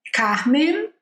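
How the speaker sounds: "Carmen" with an American R and a clear final N; English Carmen. Shown as a demonstration. Teacher showing English "Carmen" against the Portuguese one. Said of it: "em inglês".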